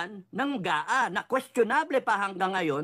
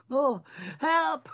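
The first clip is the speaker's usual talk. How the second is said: high, hammed-up call for help; mock cry for help; 7 semitones higher than usual. Mocking the flood, voicing stranded people crying for help. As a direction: play a drowning citizen, half-joking. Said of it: "sabi ng mga tao".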